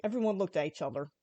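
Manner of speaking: ordinary delivery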